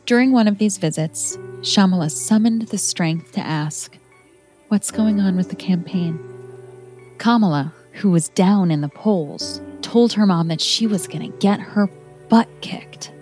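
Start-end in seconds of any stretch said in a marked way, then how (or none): none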